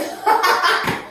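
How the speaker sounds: airy, high laugh with no voice; breathless laugh. Real, not acted. Hearing mountain climbers are next, a breathless laugh. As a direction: laugh at the dreaded next move.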